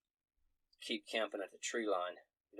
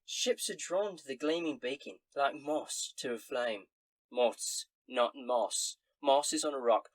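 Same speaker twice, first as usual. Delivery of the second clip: higher, chatty barmaid; barmaid gossip voice; 4 semitones higher, 3 dB louder than usual. Acted. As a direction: spill a rumor like a barmaid. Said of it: "she says".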